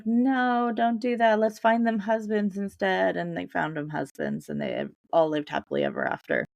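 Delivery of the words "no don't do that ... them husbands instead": Cinderella voice. Retold or imitated. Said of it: "Cinderella's like".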